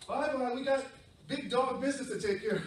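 high, loud bustling farewell; parent dogs. Acted. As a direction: wave off the pups importantly. Parent dogs leaving importantly.

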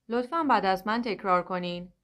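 ordinary delivery

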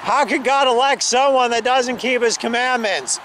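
usual speaking voice

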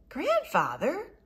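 high, loud, drawn-out protest of the name; a boy caught off guard calling out; shocked cry. Acted. Thomas shocked by grandfather's admission.

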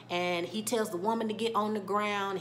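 normal talking voice

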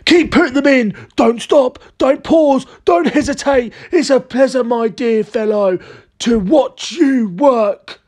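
high, giddy character voice, slowing to a relish; excited cry. A narrator's reading. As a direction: urge him on excitedly, then draw out the delight. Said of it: "cried Mister Cranky, dancing around the kitchen".

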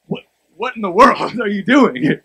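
amused shock at his friend carrying a cup; laughing disbelief. Retold or imitated. Preacher retelling his own amused shock at a friend, laughing. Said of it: "I looked at him because I was".

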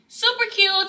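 high delighted squeal; excited. Real, not acted. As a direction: gush over it, way up high. Gushing over the scrunchie.

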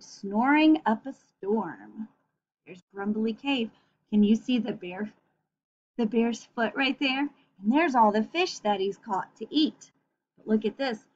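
usual speaking voice

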